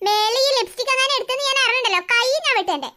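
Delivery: normal talking voice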